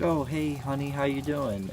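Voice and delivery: high, bright motherly greeting; cheery mom. Retold or imitated. Mom greeting her son in a high cheery voice. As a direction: sing out a sweet hello like a mom.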